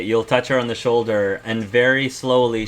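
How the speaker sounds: ordinary delivery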